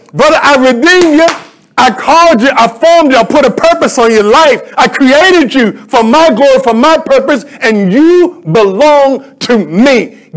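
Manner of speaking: high, ringing sermon voice; impassioned preaching. Acted. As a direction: build a rhythmic list, slowing on the last phrase. Preaches in God's words.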